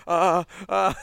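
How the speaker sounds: flustered, caught-out "ah, ah"; a rabbit in the headlights, stuck for words; mock startled girl; startled stammer.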